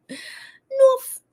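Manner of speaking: squeaky playful. Pretend. Streamer teasing chat, squeaking North up high. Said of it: "Up north".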